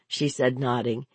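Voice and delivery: usual speaking voice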